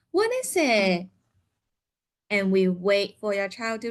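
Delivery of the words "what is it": prompting question. Shown as a demonstration. Therapist prompting child with a bright question. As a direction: ask the child brightly, leaning on "What". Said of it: "We can actually say".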